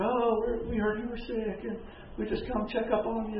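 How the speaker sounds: the flattering envoys, high and soft; mock sweet visitors. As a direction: put on the visitors' sugary concern. Mocking the Babylonian visitors' flattery.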